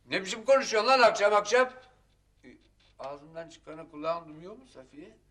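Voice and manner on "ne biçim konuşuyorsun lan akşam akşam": loud, fast, raised-pitch rebuke; angry scolding. Acted. Husband scolds wife at night, angry loud rebuke. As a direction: tell her off in a quick burst.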